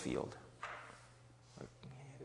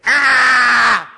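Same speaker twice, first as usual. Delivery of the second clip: loud high mock baby scream; baby wail; 16 dB louder than usual. Pastor imitating hungry baby, loud wail.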